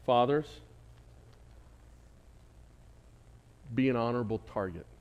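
closing sermon push, loud at first then settling; preaching exhortation. Acted. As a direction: urge the fathers from the pulpit.

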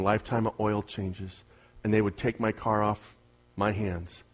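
low, soft, confiding anecdote; softly told sales story; quiet storytelling aside. Acted. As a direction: lean in and tell it low and easy.

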